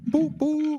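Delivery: high squeaky boop boop; horn toot noise. Pretend. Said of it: "That's a sultry voice there".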